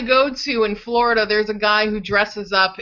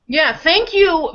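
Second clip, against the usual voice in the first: ordinary talk, nothing marked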